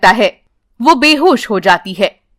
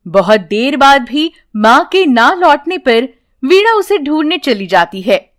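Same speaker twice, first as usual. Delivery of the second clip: usual speaking voice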